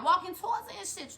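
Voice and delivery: usual speaking voice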